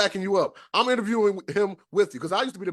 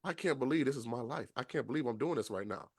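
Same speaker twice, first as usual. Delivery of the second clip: soft, awed, lower murmur; hushed disbelief; 5 semitones lower, 10 dB quieter than usual. Retold or imitated. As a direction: breathe it out in amazement. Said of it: "you were like".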